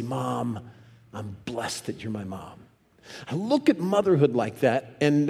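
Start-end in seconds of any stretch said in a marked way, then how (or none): 0.0-2.6 much softer and lower, tender; tender gratitude. Acted. Grown kids praise their mom, softly grateful. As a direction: say it quietly, as heartfelt thanks. Grateful child's words to mother.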